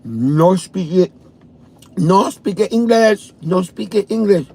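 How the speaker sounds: lightly voiced broken English, rising; imitating the neighbors' broken English, mildly; mock broken English. Imitating the Chinese neighbors, mild mock broken English. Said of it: "talking Chinese off the boat".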